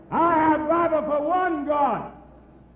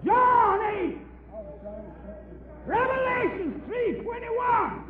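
calling out verses; 9 semitones higher, 4 dB quieter, slower than usual. Real, not acted. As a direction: shout the verse across the hall. Heated debate, calling out verses.

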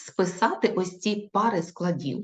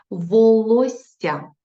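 Волосся stretched out very slowly, higher and louder; slow dictation; 4 semitones higher, 7 dB louder, much slower than usual. Shown as a demonstration. Teacher dictating to pupils, very slow and drawn out. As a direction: draw out Волосся syllable by syllable. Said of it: "Наступне слово".

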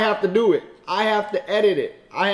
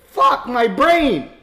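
ordinary talk, nothing marked

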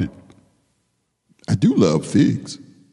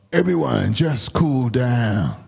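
ordinary delivery

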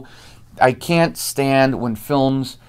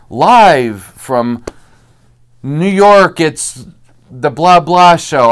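higher, louder drawn-out announcer call; 3 semitones higher, 5 dB louder, much slower than usual. Retold or imitated. Announcer introduces the talk show, hammed-up announcer boom. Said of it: "the announcer's like".

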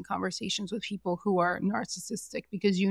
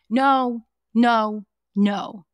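louder, firm repeated no; emphatic no; 8 dB louder, much slower than usual. Real, not acted. Host shouting down Sandoval's excuse, loud and firm. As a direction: shut the excuse down hard.

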